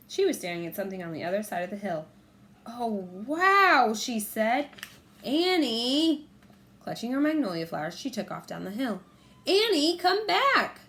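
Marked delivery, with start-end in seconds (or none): 2.7-3.9 loud, awed wow; amazed. A narrator's reading. Annie amazed. 5.2-6.3 loud, higher call. A narrator's reading. Jack calling after sister. 9.5-10.8 loud, much higher shout. A narrator's reading. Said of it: "Jack shouted".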